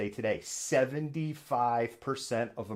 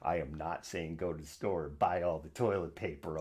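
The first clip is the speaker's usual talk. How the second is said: softer, deliberate restatement; quieter repeat; 5 semitones lower, 6 dB quieter than usual. Shown as a demonstration. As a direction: say it again softer and firmer. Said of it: "Let me say it again".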